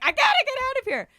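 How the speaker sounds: panicked. Retold or imitated. Panicking in a chaotic airport.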